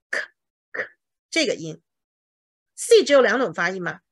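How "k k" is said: crisp lone k, repeated with a gap; k as a bare consonant; isolated hard C sound. Shown as a demonstration. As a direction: say just the k sound, no letter name. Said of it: "第一个C念的是".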